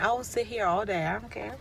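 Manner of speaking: ordinary talk, nothing marked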